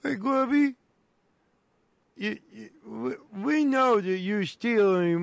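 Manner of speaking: high sidekick voice. Acted. Sidekick voice again.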